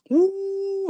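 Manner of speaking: high, drawn-out "woo"; moved whoop. Real, not acted. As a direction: let out a long, high woo in sympathy. Reacting to an emotional farewell video.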